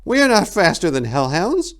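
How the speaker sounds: high and loud with alarm; frightened young monk; panicked. A narrator's reading. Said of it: "panic rising in his voice".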